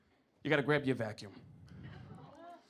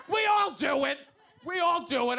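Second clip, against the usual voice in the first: higher, louder sassy voice for the cricket; mock sassy bug; 8 semitones higher, 3 dB louder than usual. Comic voicing the cricket caught in the pantry, sassy. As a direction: give the bug a cheeky diva voice. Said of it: "I was like".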